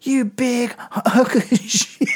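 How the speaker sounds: high, slow yelled insult breaking into a laugh; laughing mock yell. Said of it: "we're just yelling".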